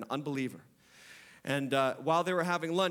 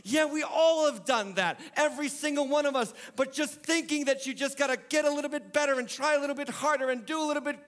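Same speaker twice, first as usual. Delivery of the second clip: higher, louder preaching, pressing the point home; full-force preaching; 11 semitones higher, 4 dB louder than usual. Acted. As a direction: preach it hard to the congregation.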